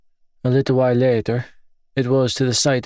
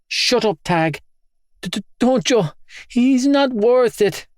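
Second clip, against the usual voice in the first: frightened plea; 12 semitones higher than usual. Acted. Sister pleading during fight.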